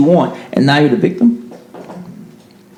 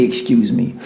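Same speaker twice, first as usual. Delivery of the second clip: soft, lower "excuse me", over-polite; overly polite; 6 semitones lower, 6 dB quieter than usual. Shown as a demonstration. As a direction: make "excuse me" timid and overly nice. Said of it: "Like, overly".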